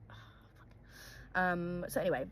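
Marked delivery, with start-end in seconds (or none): 0.1-0.7 whispered reaction to bad news; whispered dismay. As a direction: let it out as a hushed, stunned whisper.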